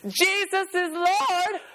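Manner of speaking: high, slow, sing-song proclamation; mom answering the phone. Retold or imitated. Mom answering the phone to the caller, slow high proclamation. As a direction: stretch it out like a proud churchgoing mom. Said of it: "and she would say".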